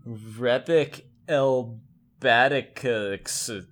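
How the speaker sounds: Repic Elbaticus said slowly, syllable by syllable, as a name spoken backwards; backwards speech. Shown as a demonstration. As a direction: pronounce Repic Elbaticus slowly and deliberately like a reversed incantation. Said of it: "I'm gonna say it out loud".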